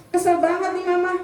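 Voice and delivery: high, mock-annoyed complaint; mother venting at her son, playfully cross. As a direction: sound fed up as the mother. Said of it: "kalau marah begitu tuh emaknya".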